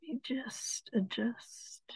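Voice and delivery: hushed off-mic aside; soft whispered remark. Real, not acted. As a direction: whisper to yourself while fixing something. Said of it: "aside while adjusting book".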